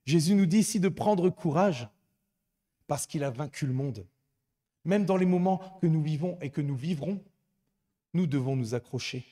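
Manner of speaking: preaching at full force. Acted. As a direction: exhort the congregation with fervour. Preacher exhorting congregation.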